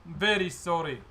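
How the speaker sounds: put-on sorry goblin in a Russian accent; slow, contrite confession with a put-on Russian accent. Goblin confessing at the inspector's feet, pleading Russian accent. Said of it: "doing a Russian accent for some reason".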